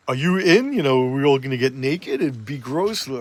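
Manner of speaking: a hint of a put-on girl's voice; lightly voiced eager invitation. Girl asks friend if she's in, lightly mocked.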